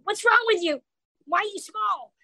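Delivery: höher, naive Kinderfrage; curious kid. Retold or imitated. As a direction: ask like a blunt curious child. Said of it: "kids come up to me and go".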